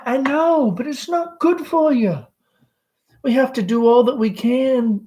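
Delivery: high nervous Southern mother; anxious mother Amanda. Acted. Amanda voiced by reader.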